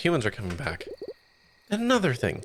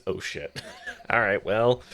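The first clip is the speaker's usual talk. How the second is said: ordinary talk, nothing marked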